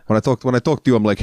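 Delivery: usual speaking voice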